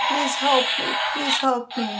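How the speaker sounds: high-pitched acted cry for help; screaming for help. Trapped lion calling from the tree, mock screaming. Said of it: "And he's screaming".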